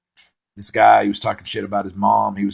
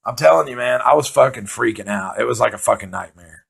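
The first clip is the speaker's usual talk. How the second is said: ordinary delivery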